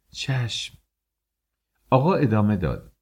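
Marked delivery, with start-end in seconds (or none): 0.1-0.8 soft, high, faint reply; weak voice. A narrator's reading. Maliheh answers the master obediently, in a faint high voice. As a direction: let it out small and meek. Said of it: "با صدای ضعیفی جواب داد".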